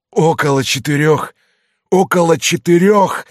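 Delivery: «Около четырёх!» громко и выше, с издёвкой; передразни со злой насмешкой; mocking. A narrator's reading. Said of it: "насмехалась злая особа". (насмешливо, всё громче)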